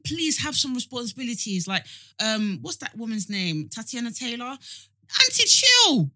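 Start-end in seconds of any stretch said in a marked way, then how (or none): 5.2-6.1 high, loud playful call-out. Real, not acted. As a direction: shout it at her like a scolding auntie. Calling out a celebrity to calm down.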